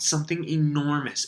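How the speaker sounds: ordinary delivery, nothing marked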